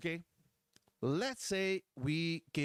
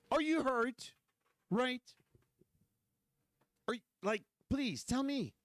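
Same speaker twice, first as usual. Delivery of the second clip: sarcastic, overly concerned asking, pitched higher; mock politeness; 5 semitones higher than usual. Homeowner mock-asks a burglar he is beating, sarcastically polite. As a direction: play it as a falsely caring check-in. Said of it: "take the time to ask a guy".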